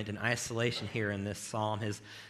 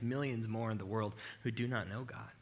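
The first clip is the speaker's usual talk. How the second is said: normal talking voice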